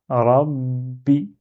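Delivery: Rabbi said slowly, holding the double B; lingering on the shadda. Shown as a demonstration. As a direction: pause on the B in "Rabbi", then release the i. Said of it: "tu t'attardes un petit peu dessus".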